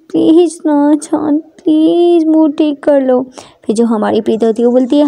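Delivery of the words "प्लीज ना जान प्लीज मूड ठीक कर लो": slow, higher, drawn-out begging; coaxing plea. Acted. Ishani begs her darling to cheer up, coaxing. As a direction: draw out each please to coax her.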